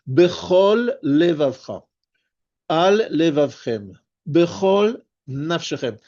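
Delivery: slow, each of Bechol Levavcha, Al Levavchem, Bechol Nafshechem set apart; careful word separation. Shown as a demonstration. Teacher demonstrating words ending and starting alike, careful separation. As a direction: finish each word fully before starting the next, slowly. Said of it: "Chaque fois, il y a un lamed qui".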